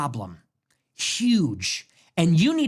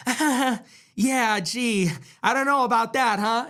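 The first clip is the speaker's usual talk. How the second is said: meek pushover; 3 semitones higher than usual. Acted. Americans who just take it when insulted, mock meek. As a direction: imitate a hesitant, spineless pushover. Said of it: "We say".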